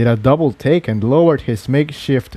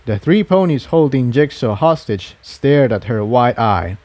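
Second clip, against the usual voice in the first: usual speaking voice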